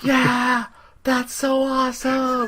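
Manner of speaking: very high, stretched squeal; mock overjoyed. Friend thanking friend for a lifting belt, mock overjoyed. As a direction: gush it high and slow, hammed-up delight. Playing overjoyed at a surprise gift.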